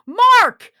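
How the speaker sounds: loud, high yell across the station; a sudden loud cry for help; shouting for Mark. Acted. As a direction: bellow his name in alarm. Host yells for his co-worker.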